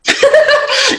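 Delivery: bursting high laugh. Real, not acted. Streamer laughing while reflecting on weird clients, a high laugh.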